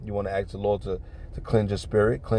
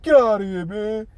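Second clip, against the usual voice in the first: high-pitched incredulous dismissal; acted disbelief; 11 semitones higher, 3 dB louder than usual. Man reacting to a wounding insult, disbelieving. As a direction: imitate him reeling in disbelief.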